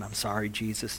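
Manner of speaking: low, soft apology; ashamed. Acted. Peter apologizing to Jesus after doubting, quietly ashamed. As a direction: apologize quietly, head hung in shame. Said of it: "hanging his head in shame".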